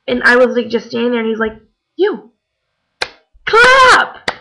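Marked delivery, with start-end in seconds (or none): 1.9-4.3 high, loud shouted command; a sudden high yell of clap; ordering her to clap. Retold or imitated. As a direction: bark the order at one fan. Singer singles her out.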